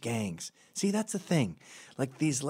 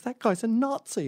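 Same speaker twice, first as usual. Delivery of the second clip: much higher, accusing squeal; mock shrill accusation; 3 dB louder than usual. Woman at London airport accuses him, mock shrill squeal.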